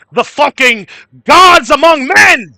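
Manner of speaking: slow, high, booming mock proclamation; mock-grandiose shout. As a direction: bellow it like an over-the-top joke rally speech. Mock proclamation of gamer supremacy, a joke.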